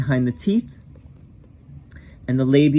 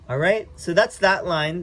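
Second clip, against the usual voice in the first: usual speaking voice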